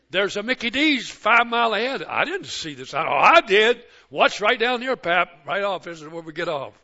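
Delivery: put-on bouncy kid pointing out the exit; excited grandkids. Grandkids tell Pap about the exit, eager and loud.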